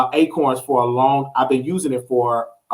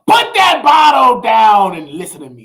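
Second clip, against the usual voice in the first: calling to mama; 5 semitones higher, 5 dB louder than usual. Retold or imitated. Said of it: "call my mama".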